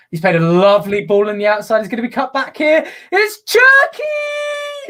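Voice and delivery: rising from loud commentary to a long high scream; building goal call. Real, not acted. Commentator following Lyon's attack to Cherki's goal, building to ecstatic shout. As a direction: build and explode on the name.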